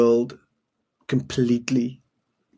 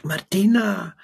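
higher mock scream from deep down; imitating a desperate mother calling her child, higher; retold deep scream; 6 semitones higher, slower than usual. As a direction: cry the name out from the gut, as a retelling. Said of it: "loud, but screaming from deep down here".

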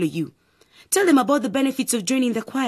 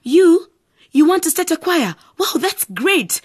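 excited; 9 semitones higher, 5 dB louder than usual. Acted. Sister thrilled by choir news.

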